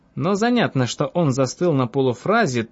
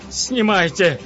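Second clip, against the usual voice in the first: higher, panting royal command; breathless king orders; 6 semitones higher, slower than usual. A narrator's reading. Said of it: "приказал он, задыхаясь".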